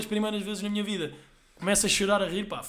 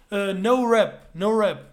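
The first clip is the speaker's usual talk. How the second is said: mock waiter refusing; 4 semitones higher, 8 dB louder, much slower than usual.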